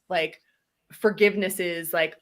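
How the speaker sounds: usual speaking voice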